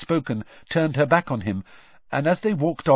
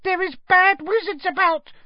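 very high-pitched frightened elf voice; squeaky house elf; 4 dB louder than usual. A narrator's reading. House elf struggles out of the bushes, squeaky.

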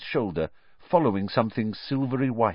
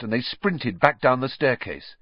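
ordinary delivery, nothing marked

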